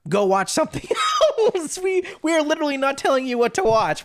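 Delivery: high, loud, cracking up mid-line; loud laughing protest, voice shooting up; laughing mock exasperation. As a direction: put on exasperation and burst out laughing. Mock exasperated quote, breaking into laughter.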